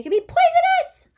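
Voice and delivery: very high, loud poisonous; emphatic excitement. Shown as a demonstration. Teacher showing her exclamation point, thrilled. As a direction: shout it with delight. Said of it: "I wanted to emphasize it".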